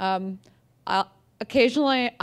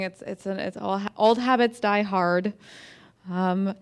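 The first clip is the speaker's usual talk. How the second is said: ordinary talk, nothing marked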